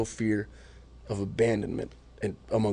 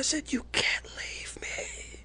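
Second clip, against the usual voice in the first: slow, softer breathy plea; broken whisper; 13 semitones higher, 12 dB quieter, much slower than usual. Retold or imitated. Husband begging wife not to leave, whispering. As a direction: barely get the words out, slow. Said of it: "And I just screamed it".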